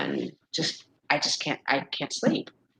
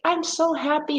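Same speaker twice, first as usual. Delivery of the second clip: gushing joy; 12 semitones higher than usual. Retold or imitated. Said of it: "I'd be like".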